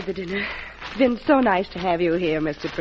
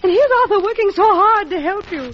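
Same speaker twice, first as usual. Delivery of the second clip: higher, rising indignation in defence of her husband; upset protest; 8 semitones higher than usual. Acted. Wife defending her husband to the president, indignant. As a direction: push back hard, hurt and indignant.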